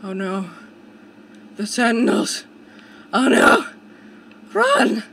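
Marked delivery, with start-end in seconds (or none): 1.6-5.0 rising panicked shout; alarmed. Real, not acted. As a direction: yell a warning and flee. Enemies appear in game.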